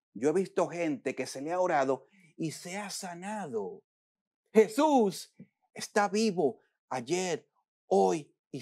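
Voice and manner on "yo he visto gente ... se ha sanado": usual speaking voice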